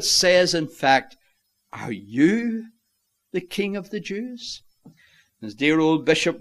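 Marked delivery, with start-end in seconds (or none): 1.7-4.6 curiosity and contempt. Acted. Pilate sizing up the prisoner before him, curious and contemptuous. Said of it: "a mixture of curiosity and contempt".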